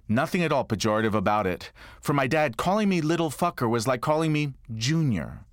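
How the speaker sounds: ordinary talk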